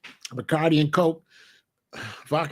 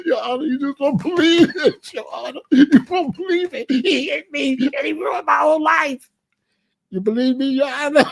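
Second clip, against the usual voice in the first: high, loud mock sobbing plea; fake crying to the judge; 10 semitones higher, 4 dB louder than usual. Faking tears before the judge for a lawsuit, much higher mock sobs. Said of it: "I take some onion to court".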